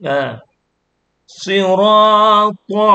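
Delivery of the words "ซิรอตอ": "ซิรอ ตอ" chanted higher and louder with full, heavy s and t; thick heavy letters. Shown as a demonstration. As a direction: make "ตอ" fat and thick after a long "ซิรอ". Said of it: "ตัวตอตั้งหนาตั้งอ้วน".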